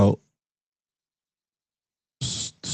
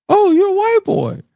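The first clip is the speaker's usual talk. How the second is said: put-on high, wide-eyed kid voice; childlike surprise. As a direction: squeak it like a five-year-old.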